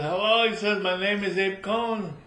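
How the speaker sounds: high, eager hello; friendly greeting. Acted. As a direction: introduce yourself brightly.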